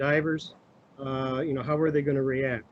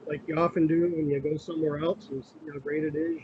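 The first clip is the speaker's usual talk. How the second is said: usual speaking voice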